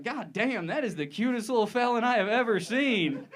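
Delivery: high, loud gushing judge; charmed judge. Acted. Judge melting at a cute felon, hammed-up gushing.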